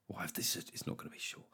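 whispered worry; mock paranoid whisper; anxious whisper. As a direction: whisper it like a nervous employee. Anxious employee's inner worry.

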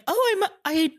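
high, feigned innocent voice; a lightly voiced clueless friend faking surprise; innocent Casey. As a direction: play dumb like you honestly forgot. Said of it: "Casey fully just gaslit us and was like".

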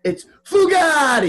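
high, drawn-out exclamation; crowed nickname. Acted. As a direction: belt out the name like a big reveal. Crowing the friend's mistaken name.

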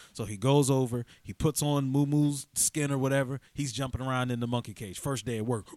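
ordinary talk, nothing marked

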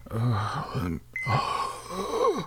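slow, weak and strained at first, then high and strained; dying man voice. Pretend. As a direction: read it like a man on his deathbed. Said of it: "read this in the voice of a dying man".

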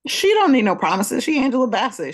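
emphatic, amused hype; sassy defence. Pretend. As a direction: proclaim it with mock attitude. Playful put-on defence of the actress.

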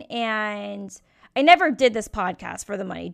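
ordinary delivery

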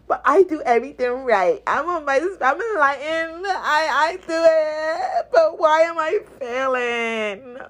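drawn-out, rising mock complaint; hammed-up aggrieved enlightened type; mock whining. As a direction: whine like a self-pitying know-it-all. Mimics entitled people complaining of failure.

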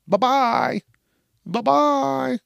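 high, sing-song farewell; mocking bye-bye. Mocking the complainers as the singer flies off, sing-song. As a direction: wave them off in a high, teasing tone.

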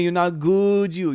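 ordinary talk, nothing marked

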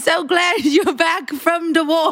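mangled Southern belle through laughter; botched Southern accent. Acted. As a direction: attempt a Southern accent with distorted sounds. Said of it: "It sounds like you're making fun of deaf people".

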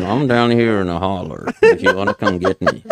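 laughing imitation of a Kentucky neighbor; Kentucky accent. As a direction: talk like a rural Kentucky local. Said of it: "is it a Kentucky accent?".

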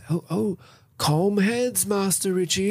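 high, smooth voice, soothing a man with a gun; Leonard impression. Podcast host voicing Leonard to calm Richie, high and smooth. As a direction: play the unflappable schemer talking Richie down.

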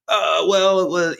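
hesitant, caught-out candidate; mild mock stammer of a graduate; stumped candidate.

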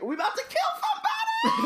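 high squealing shout as Voldemort. Retold or imitated. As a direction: shriek it like an excited Voldemort. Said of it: "Voldy is like".

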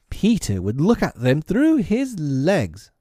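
put-on slow, high, swooping boyish voice; high and drawn-out, childlike; boys crying out. A narrator's reading. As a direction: call out the idea like a band of boys. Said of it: "Almost in the same breath they cried".

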